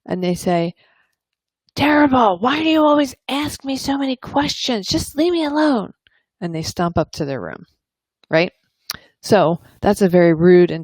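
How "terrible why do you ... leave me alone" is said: put-on high, whiny teen voice; moody teen pushing a parent away; sulky teenager. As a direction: snap back like a fed-up teenager.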